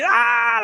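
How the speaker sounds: very high mock scream; imitated child's scream. Dad imitating his son's scream toward the sea, very high. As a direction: squeal like a kid bolting.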